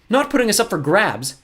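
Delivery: put-on high, loud indignant girl; astonished. A narrator's reading. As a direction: protest the betrayal, pitched high. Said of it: "in astonishment".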